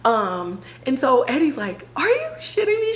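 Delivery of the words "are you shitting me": high incredulous yelp; imitating a stunned coworker; shocked disbelief. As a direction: react as if you can't believe it. Eddie reacting in disbelief.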